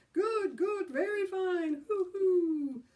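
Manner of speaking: high, loud manic crowing; giddy high-pitched loony voice; crazy hooting. Acted. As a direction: hoot like a madman. Billy acting crazy, flapping.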